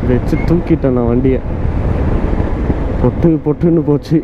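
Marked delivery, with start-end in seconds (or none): none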